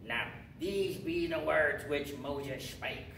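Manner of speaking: slow, slurred pirate drawl; pirate accent. Shown as a demonstration. Teacher showing a memory trick for Deuteronomy, pirate accent. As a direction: read scripture like a pirate, drawing out the words. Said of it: "just do your best pirate accent with me right there".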